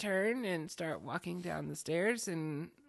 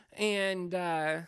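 usual speaking voice

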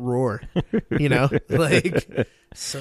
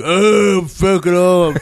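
mock party shout; 5 semitones higher, 8 dB louder, slower than usual. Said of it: "he's like".

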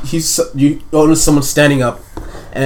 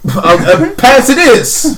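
high, loud, drawn-out answer; flustered guard; 11 semitones higher, 6 dB louder, much slower than usual. Acted. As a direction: blurt it out high and stretched. Flustered guard's reply, played comically.

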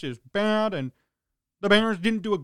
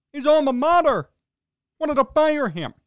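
emphatic outburst; 7 semitones higher, 6 dB louder than usual. Real, not acted. Emphatic rant point.

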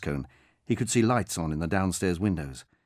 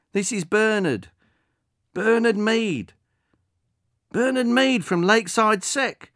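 much higher and louder, excited reunion voice; delighted old friend; 11 semitones higher, 6 dB louder than usual. Acted. Old schoolmate revealing himself to Margaret, delighted.

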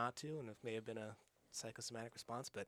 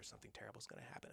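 fast, breathless whispered dread; whispered retold panic; panicked whisper; 11 dB quieter, much faster than usual. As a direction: rush it out under your breath in panic. Retold panicked inner thought.

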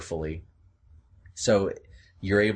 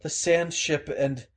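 higher, shaken confused voice; dazed Gerald; 8 semitones higher than usual. Acted. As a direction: stammer out the memory, frightened. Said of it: "Gerald is just gasping".

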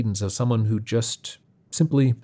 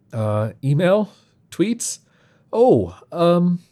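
high, halting, questioning; flustered surprise; 9 semitones higher, 6 dB louder, much slower than usual. A narrator's reading. Shane is asked about email and tweets, flustered and surprised. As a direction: stumble in a high voice over each word.

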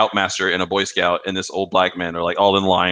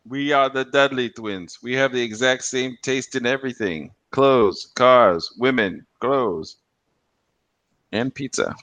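slow, lightly voiced ad twins; a hint of cheesy commercial twins; lightly mock twins ad; 3 semitones higher, slower than usual. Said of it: "that fucking commercial".

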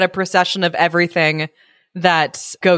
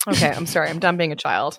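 ordinary delivery

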